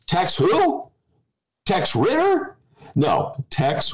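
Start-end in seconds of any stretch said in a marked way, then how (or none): 0.1-2.6 puzzled questioning. Retold or imitated. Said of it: "at which point Dennis said".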